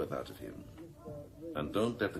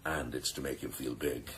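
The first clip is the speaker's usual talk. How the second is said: ordinary talk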